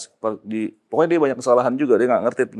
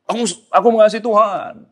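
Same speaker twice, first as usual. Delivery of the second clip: higher, louder mock proclamation; mock boastful shout; 9 semitones higher, 4 dB louder than usual. Preacher mimicking a loudmouth believer, mock boastful shout. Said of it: "orang yang suka teriak-teriak".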